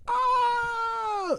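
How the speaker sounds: ordinary talk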